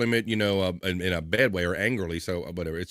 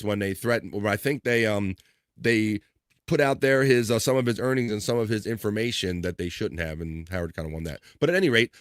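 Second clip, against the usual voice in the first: usual speaking voice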